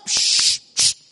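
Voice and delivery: sharp voiceless shush; shushing hiss. Retold or imitated. Judge silencing the protesting wife, sharp shush. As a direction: hush her with a loud psst.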